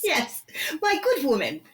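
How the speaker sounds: high-pitched mock-British; British accent. Guest gleefully echoing the host's show title, British accent. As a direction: echo it back in a playful British accent. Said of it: "in a British accent".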